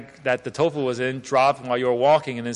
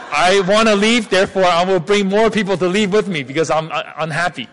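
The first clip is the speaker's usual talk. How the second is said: raised, loud protest in mock indignation; mock indignant; 4 semitones higher, 6 dB louder than usual. Interpreter voicing an unhappy listener leaving, mock indignant. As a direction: announce a walkout as an offended attendee.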